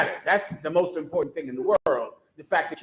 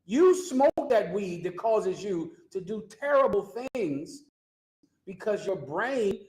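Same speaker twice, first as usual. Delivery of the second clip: raised, urgent sermon voice, pitched higher; preaching; 5 semitones higher than usual. Acted. Preacher warns young people about drugs, pitched higher. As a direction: hammer it home like a street preacher.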